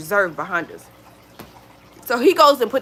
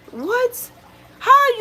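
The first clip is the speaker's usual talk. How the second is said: incredulous what; 4 dB louder, much slower than usual. Real, not acted. Indignant at staring cranes.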